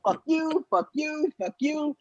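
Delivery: mock tirade stuck on repeat; repeated taunting. Comic repeatedly cursing the crowd, high mock taunt. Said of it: "I just kept going over and over".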